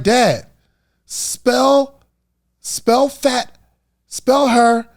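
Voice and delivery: high, spaced-out words, imitating a daughter; insistent daughter. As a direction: spell it out for dad, one piece at a time. Daughter patiently retries with dad, voiced.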